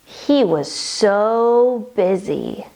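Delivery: slow, stretched-out refrain; slow storytelling. A narrator's reading. Reader telling kids of Squirrel, slow and drawn out. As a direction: draw out each word for small listeners.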